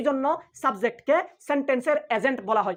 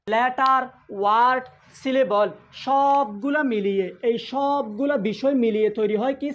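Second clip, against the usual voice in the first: slow emphatic teaching; slower than usual. Shown as a demonstration. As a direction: stretch each term out for the class. Teacher drills key line slowly. (ধীরে, টেনে টেনে জোর দিয়ে বলা)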